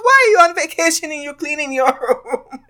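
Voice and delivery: high, amused disbelief with a laugh; high laughing housekeeper. Retold or imitated. Housekeeper to guest who cleaned room, high laughing disbelief. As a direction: squeal it like a surprised housekeeper. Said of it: "She's like".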